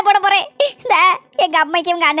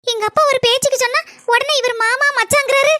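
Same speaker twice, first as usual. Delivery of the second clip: ordinary talk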